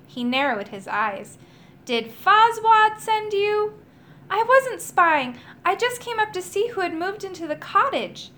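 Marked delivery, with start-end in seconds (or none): 1.9-3.7 slow, louder, higher suspicious question; suspicious magician. A narrator's reading. Magician suspecting the boy was sent, slow and pointed. 4.3-8.3 put-on boy's voice. A narrator's reading. Edward denying he was spying, higher and protesting. Boy defends himself.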